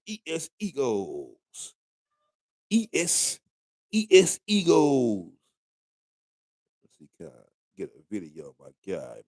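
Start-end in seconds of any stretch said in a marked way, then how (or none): 0.1-5.3 lightly imitating his two-year-old; drawn out like a toddler spelling; slow toddler spelling. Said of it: "He said".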